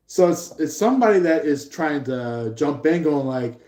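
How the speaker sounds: ordinary talk, nothing marked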